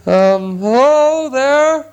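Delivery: high, slow, trembling call from afar; timid hobbit calling to a stranger, shaky. A narrator's reading. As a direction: call out nervously, voice wobbling. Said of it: "he called in a shaky voice".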